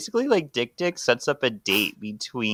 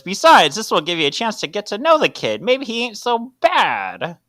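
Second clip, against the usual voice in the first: noir detective; 10 semitones higher, 5 dB louder than usual. Acted. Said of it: "sounds just like the Noir voice".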